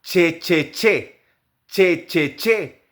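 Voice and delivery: che with a crisp ch sound. Shown as a demonstration. Teacher drilling the Spanish che sound. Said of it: "el de la che en español".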